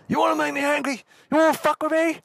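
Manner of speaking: very high, strained mock yell; angry threat. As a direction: threaten like an enraged man losing it. Acted receptionist attacking the narrator.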